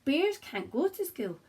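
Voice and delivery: lively storybook read-aloud in rhyme; reading to children. Acted. Teacher reading a picture book aloud to her class, lively and gentle. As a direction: read it out like bedtime for little ones.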